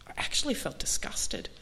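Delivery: breathy whispered aside. Real, not acted. Telling the group her disgust, whispered. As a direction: confide it under the breath. Realising she didn't want drink.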